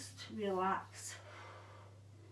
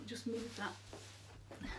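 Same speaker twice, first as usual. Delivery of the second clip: whispered aside; 10 dB quieter, much slower than usual. Real, not acted. Aside while adjusting the camera.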